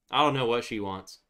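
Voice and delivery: normal talking voice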